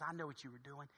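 low, very soft voice; confiding apology. Acted. Gate agent apologizing to the kind passenger, hushed confiding. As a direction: keep it hushed and low, just between you.

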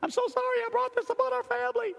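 very high, fast whine imitating the wife; hammed-up tearful apology in one rushed wail; mock wailing apology. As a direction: squeal it out as an overwrought apology. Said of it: "She's apologizing for being sick".

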